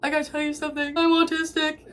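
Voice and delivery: high-pitched tearful confession, hammed up; exaggerated weepy squeal breaking into laughter; mock crying. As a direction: blurt it out as if bawling. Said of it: "So I come in just crying".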